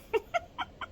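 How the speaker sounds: short high laugh. Real, not acted. Viewer amused by the line, a quick high laugh.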